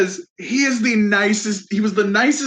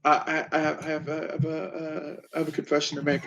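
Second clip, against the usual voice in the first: much lower and much softer, halting; mock sheepish confession; 8 semitones lower, 10 dB quieter than usual. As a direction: mumble it out like a guilty kid.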